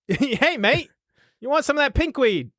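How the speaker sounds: high, hammed-up Australian twang, a bit fast; mock Aussie accent. Joking about the cereal being Aussie, hammed-up Australian accent. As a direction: play the cereal as a cheeky Aussie offering weed. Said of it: "That's not an Aussie accent".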